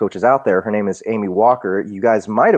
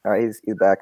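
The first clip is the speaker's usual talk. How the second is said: ordinary talk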